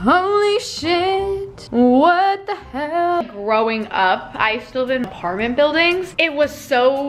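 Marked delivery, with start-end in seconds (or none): 0.0-3.2 slow drawn-out amazed exclamation; stunned. Real, not acted. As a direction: stretch it out in disbelief. Stunned by Batman at window.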